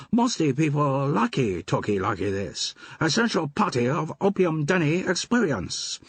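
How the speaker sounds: high put-on pidgin English, adding "y" to words; a cheeky opium-den keeper laying on a fake pidgin accent; mock pidgin accent. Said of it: "Adding E to the end of every word".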